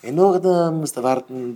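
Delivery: usual speaking voice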